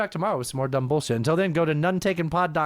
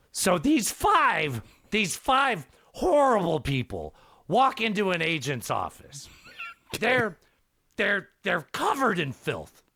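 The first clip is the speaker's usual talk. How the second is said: Gilbert Gottfried voice. Acted. Podcaster telling the Aristocrats joke to his cohost, as Gilbert Gottfried. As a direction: tell the filthy joke in a squawking Gilbert Gottfried voice. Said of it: "I need to not do the Gilbert voice".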